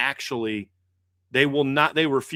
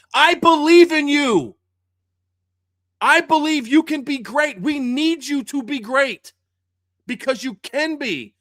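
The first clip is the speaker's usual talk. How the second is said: high, loud fired-up shout; loud, slow coach's rally cry; coach yelling encouragement; 10 semitones higher, 6 dB louder than usual. Retold or imitated. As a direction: pump the player up, yelling. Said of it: "That's what she's yelling at her".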